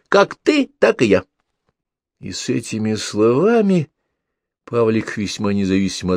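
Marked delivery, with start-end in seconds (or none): 0.1-1.2 cheeky retort. A narrator's reading. Pavlik retorts.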